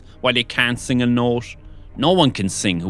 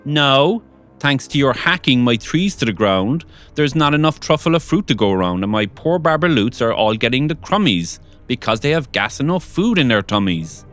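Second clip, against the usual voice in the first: higher, louder scolding creature voice; Lorax snapping; 6 semitones higher, 4 dB louder than usual. A narrator's reading. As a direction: tell him off, sharp and indignant. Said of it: "He snapped".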